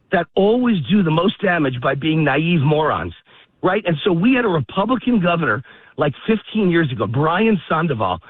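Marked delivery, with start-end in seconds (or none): none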